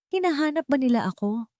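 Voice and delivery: plain reading voice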